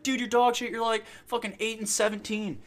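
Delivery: high, loud, whiny-gamer jab; imitating an indignant teammate; mock heckling. Imitates player mocking someone's score.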